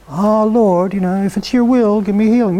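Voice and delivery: a mock limp, half-hearted prayer; lightly mocking a meek, polite asking; wishy-washy mock prayer; timid prayer. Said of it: "Don't just sit there and go".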